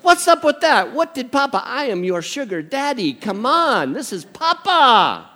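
high, loud comic protest; mock-indignant grandpa. Grandpa pleading with grandson on a video call, hammed-up indignation. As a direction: plead in exaggerated disbelief.

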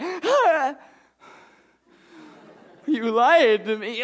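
put-on high, slow wounded child; hurt little girl. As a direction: drag it out like a betrayed toddler. Daughter voiced, hurt.

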